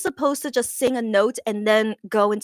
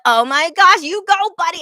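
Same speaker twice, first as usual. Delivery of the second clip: loud, raised-pitch hype; excited cheering; 8 semitones higher, 8 dB louder than usual. Retold or imitated. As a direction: cheer on a pal with delight. Reenacts cheering the performer on.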